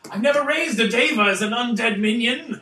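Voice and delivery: loud, high, amused musing; gleeful excitement. Real, not acted. Game master eyes a new undead minion, gleeful. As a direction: relish the idea, half laughing.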